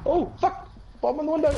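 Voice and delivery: high alarmed yell of warning; real alarm, shouting a sudden warning; startled shout. Real, not acted. Gamer shouting a warning to teammates, startled and high. Sudden enemy bomb in game.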